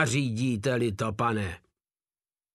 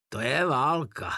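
hissed; 2 semitones higher than usual. Acted. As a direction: hiss it through the teeth. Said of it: "zasykl legionář".

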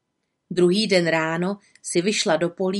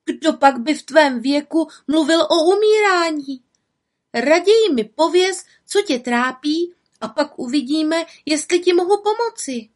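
put-on higher, louder girlish urging; eager little witch; 9 semitones higher, 4 dB louder than usual. Little witch urges the woman to share her trouble. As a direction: press her kindly to speak.

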